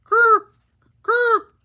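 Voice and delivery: high, loud parrot squawk; shrieking parrot call. Acted. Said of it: "Boris says".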